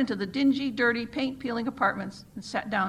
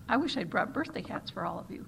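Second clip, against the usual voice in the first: plain reading voice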